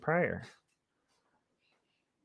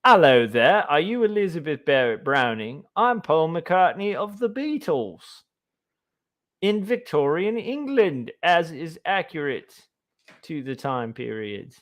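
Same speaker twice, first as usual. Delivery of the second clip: put-on light British accent, higher in the middle; 4 semitones higher than usual. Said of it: "have [name] come in, be like".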